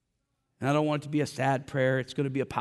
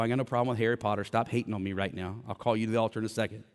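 normal talking voice

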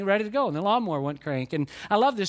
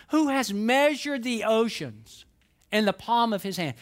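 scripture reading; 3 semitones higher than usual. Acted. Reads scripture slowly.

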